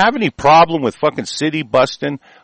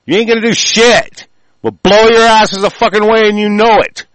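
higher, louder furious yelling threat; angry shout; 8 semitones higher, 5 dB louder than usual. Real, not acted. Ranting at government officials, a furious shouted threat. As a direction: bellow the threat at them, seething.

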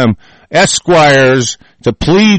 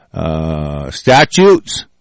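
ordinary talk

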